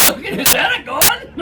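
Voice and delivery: high, startled mock voice of the intruder; startled question. Intruder spotting the gun, startled high voice. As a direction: act out his shocked double-take. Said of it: "he's like".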